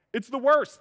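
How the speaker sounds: ordinary talk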